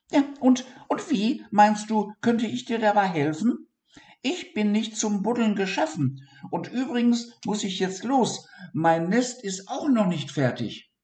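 very high, hurried mouse; high impatient hazel mouse. A narrator's reading. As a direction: brush him off, eager to leave. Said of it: "antwortete die Haselmaus".

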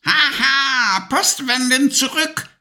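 high, gleeful witch cackle-voice; screeching witch. A narrator's reading. Witch cheering the dragon's fireballs back, screeching high. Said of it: "kreischte die Hexe".

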